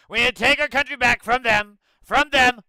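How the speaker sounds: over-the-top campaign yell; mock Trump shouting. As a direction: yell it like a crowd-pumping demagogue. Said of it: "the whole Trump thing".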